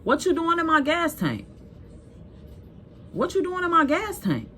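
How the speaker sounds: high retold yell from the window; mock yell out the window. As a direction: call down at him by the car. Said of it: "As I'm yelling out the window".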